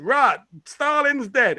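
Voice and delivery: a film character's Yorkshire announcement, hammed up; imitated Yorkshire accent. Actor comes in as Zhukov, imitated Yorkshire accent. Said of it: "It comes in".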